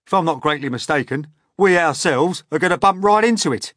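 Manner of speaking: fast, loud, high alarm; urgent centipede, loud and brisk; sharp warning. A narrator's reading. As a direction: warn them sharply, building in pitch. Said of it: "the centipede said sharply".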